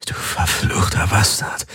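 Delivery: whispered hoarse croak; triumphant croak. Acted. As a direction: hiss the curse in triumph. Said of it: "krächzte er triumphierend".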